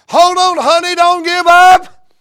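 high, loud cry to his daughter; urgent father's call. Acted. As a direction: call out to her over the distance. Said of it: "I believe he's saying".

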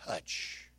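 a breathy whispered word. Acted. Preacher whispers that God has not lost touch. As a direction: drop to a whisper on the last word.

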